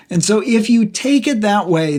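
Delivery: normal talking voice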